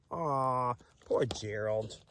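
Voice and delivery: sympathetic. Real, not acted. Sympathetic aside to kids.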